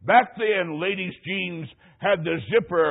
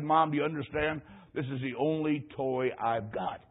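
low, soft, slowing plea; lightly acted forlorn little boy; mock boyish pleading; 3 semitones lower, 6 dB quieter than usual. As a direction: plead quietly with mom, dragging out the end. Boy pleads to keep his toy.

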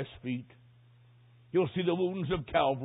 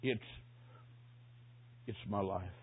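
soft, low, halting dying man; weak voice with long pauses; imitating hoarse whisper; 4 semitones lower, 12 dB quieter than usual. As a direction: struggle to get each word out softly. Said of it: "Then a hoarse whisper".

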